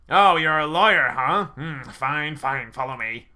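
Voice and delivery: loud sneering guard voice; scoffing guard. Acted. Guard mocking the lawyer who came in, loud sneer.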